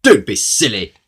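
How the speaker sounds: loud, dismissive scoff; scornful snort. A narrator's reading. Mister Uppity refusing the goblin, loud snort. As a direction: snort it out, brushing him off. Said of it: "snorted Mister Uppity".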